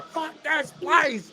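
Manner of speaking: high, drawn-out mock yell; retold yell. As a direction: yell it out long and high, held back in volume. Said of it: "I will yell out my car".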